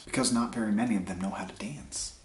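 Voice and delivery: soft, unvoiced whisper; whispered punchline. Reading a joke to viewers, punchline whispered. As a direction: let the punchline slip out under your breath.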